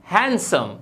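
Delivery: हैंडसम with a silent D, "HAN-sum"; correct pronunciation. Shown as a demonstration. Teacher models the word for learners, हैंडसम without the D. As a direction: drop the D from हैंडसम. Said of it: "ये होता है".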